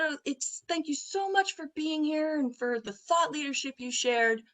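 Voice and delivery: high-pitched acted politeness; gushing polite thanks. Acts out her polite thanks to executive.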